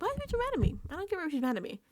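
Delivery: high-pitched imitation of an indignant complaint; mock indignant whine. Housemate complaining about Rachel, mock indignant. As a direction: whine it high, mocking him.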